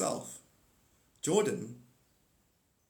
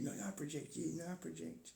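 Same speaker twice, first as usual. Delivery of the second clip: normal talking voice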